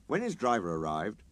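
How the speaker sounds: ordinary delivery, nothing marked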